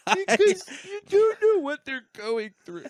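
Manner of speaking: high, wailing put-on crybaby voice; hammed-up weepy plea; mock crying drunk. Drunk man scolding the comic through tears, mock crying. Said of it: "He starts crying".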